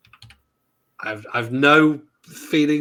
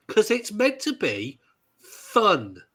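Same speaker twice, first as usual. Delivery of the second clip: ordinary talk, nothing marked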